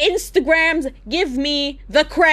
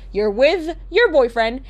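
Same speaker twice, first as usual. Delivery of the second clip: normal talking voice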